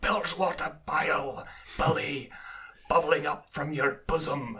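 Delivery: lightly voiced old sailor ranting a monologue; a hint of a cranky old sea dog; mock salty sea dog. Quoting Willem Dafoe's film monologue as a mild sea dog rant. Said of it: "he goes on these wild monologues".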